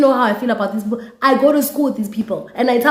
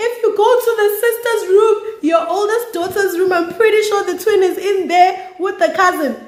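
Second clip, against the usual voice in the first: rat them out at full pitch; shrill gleeful tell-on-them; 12 semitones higher than usual. Retold or imitated. Snitching to the mom loudly.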